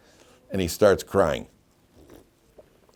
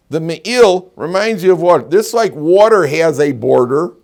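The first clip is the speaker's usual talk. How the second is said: loud, high emphatic teaching; 7 semitones higher, 8 dB louder than usual. Real, not acted. Teacher to students about speech, emphatic and loud. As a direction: drive the lesson home.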